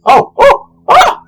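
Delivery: high, loud yelps of pain; burnt-hand panic, voice jumping up. Acted. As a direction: hop about yelping as the metal burns. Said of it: "Sirik's like".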